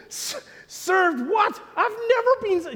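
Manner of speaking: high, stammering acted astonishment; shocked disbelief. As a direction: balk at it, pitch shooting right up. Acted shock at being served papers.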